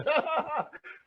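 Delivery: high burst of laughter. Real, not acted. Joking he can see the audience.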